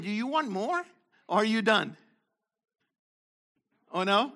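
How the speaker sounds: ordinary delivery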